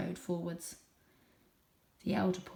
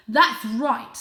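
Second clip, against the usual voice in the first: loud father voice; 4 semitones higher, 11 dB louder than usual. Acted. Father in read story.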